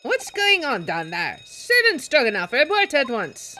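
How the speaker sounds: officer calling out. Acted. Officer calls out from below.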